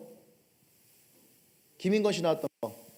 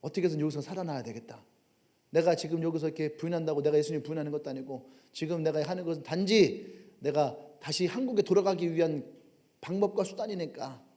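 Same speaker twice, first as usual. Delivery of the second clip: inner thought; 5 semitones lower, 6 dB quieter than usual. Acted. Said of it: "고민하면서".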